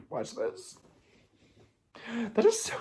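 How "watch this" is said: hushed, softer mock "watch this"; a quiet, voiceless little dare; whispered quote. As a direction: say it under your breath, smugly. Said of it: "She was like".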